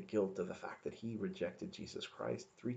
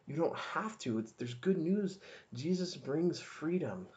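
higher, building preacher's call to the flock; rising preaching; 4 semitones higher than usual. Acted. Preacher tells friends they are new creations, rising and fervent. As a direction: lift it up, preach the freedom.

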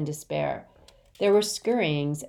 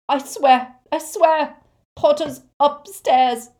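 loud, very high frantic oath; high-pitched, panicked insistence; desperate pleading; 10 semitones higher, 11 dB louder, slower than usual. A narrator's reading. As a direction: swear it as if your life depends on it. Terrified victim pleads to be believed.

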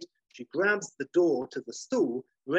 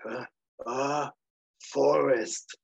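drowsy sloth; much slower than usual. Acted. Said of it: "he's like".

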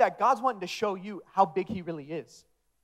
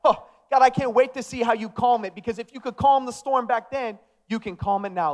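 raised, loud, confident prayer; declaration prayer; 4 semitones higher, 6 dB louder than usual. Acted. Believer praying over the storm, bold declaration. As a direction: declare it to God with rising eagerness, easing at the end. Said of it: "a prayer of declaration".

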